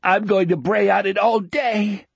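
high, muffled voice, feverishly eager. A narrator's reading. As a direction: vow it zealously through a blocked-up voice. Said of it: "had become positively feverish".

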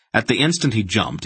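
usual speaking voice

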